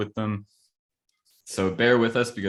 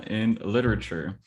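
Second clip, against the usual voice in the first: ordinary talk, nothing marked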